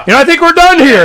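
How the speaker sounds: high, loud line spoken through a laugh; laughing brush-off. Retold or imitated. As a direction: laugh it off and leave.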